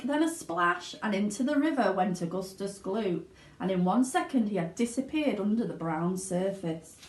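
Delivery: ordinary delivery, nothing marked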